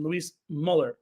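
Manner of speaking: Muller with a rounded first vowel, MOLL-er; careful umlaut attempt. Shown as a demonstration. Host corrected on his umlaut, careful attempt at Muller as MOLL-er. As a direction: try "Muller" carefully, without a y glide. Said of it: "being corrected on my umlaut pronunciation".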